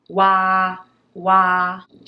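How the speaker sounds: わー with mouth wide open; long open ah in わー; open-mouthed wah. Shown as a demonstration. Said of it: "わーは口を広く開けたまま発音しますよね".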